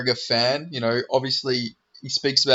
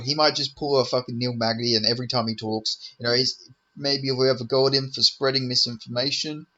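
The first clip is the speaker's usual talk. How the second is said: ordinary delivery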